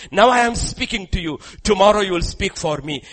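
high, loud and pressing; preaching at full force. Real, not acted. Preacher urging the congregation, high and loud. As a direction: hammer it home to the crowd.

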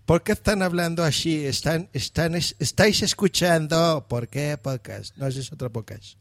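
mock Spain accent with hushy S; imitando acento español. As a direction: imitate Spaniards, every S turning to sh. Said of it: "la S la escuchamos así". (acento español exagerado, eses como "sh")

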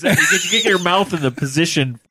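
ordinary delivery

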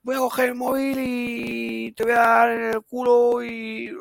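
high mock yell of a raging toddler; child's tantrum threat. Mother imitating her son threatening her during a tantrum, high mock yell. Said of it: "pero gritando muy fuerte".

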